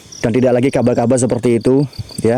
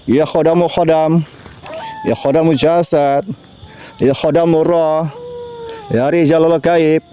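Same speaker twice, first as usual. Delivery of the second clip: ritual invocation; 4 semitones higher than usual. Acted. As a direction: summon the unseen with gravity. Memanggil khodam di makam. (memanggil khodam dengan suara khidmat dan lambat)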